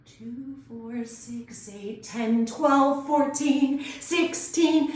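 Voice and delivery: starts as a hushed rumble, then builds to a loud, high explosion; volcano voice. Shown as a demonstration. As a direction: volcano rumble growing into an eruption. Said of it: "it might sound something like this".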